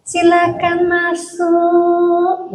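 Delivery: very slow, drawn-out Solo politeness; Solo accent. Acted. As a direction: stretch each word like a genteel Solo speaker. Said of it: "paksakan orang Batak jadi orang Solo".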